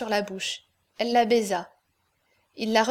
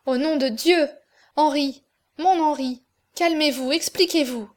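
higher, urgent pleading; supplication alarmée; 4 semitones higher, 5 dB louder than usual. A narrator's reading. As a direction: beg him to calm down, voice raised. Aurore begs Henri to calm down. (supplication pressante, voix plus haute)

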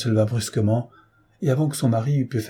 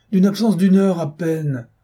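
higher, exasperated husband; impatient protest; 7 semitones higher, 4 dB louder than usual. Acted. Husband brushing off his wife's worry, impatient. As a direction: protest with raised pitch. Husband argues with pleading wife.

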